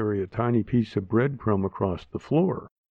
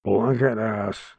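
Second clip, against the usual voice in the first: put-on slow slurred old man blurt; drunk uncle blurt; slower than usual. Drunk uncle blurts at little girl, slow and slurred. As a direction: blurt it out half asleep and drunk. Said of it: "he blurted out".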